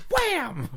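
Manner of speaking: very high, sharp "wham"; mimicking a slap for laughs; sound effect. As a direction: smack the word like a cartoon hit. Imitating the slap.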